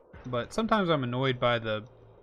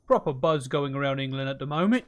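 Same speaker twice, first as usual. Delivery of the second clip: a lightly put-on, louder British accent; bad British accent; 5 dB louder than usual. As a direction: try a clumsy English accent on it. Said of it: "That's not a very good accent".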